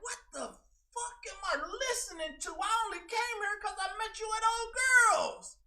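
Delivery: high, loud complaining voice; mock bored viewer. Streamer imitating a bored viewer complaining, high and loud.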